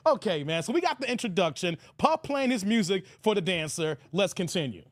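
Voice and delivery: normal talking voice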